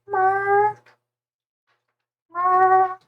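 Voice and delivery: high, drawn-out camel call; imitated camel bleat. Streamer showing how camels go, imitated camel bleat. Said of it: "Camels go like".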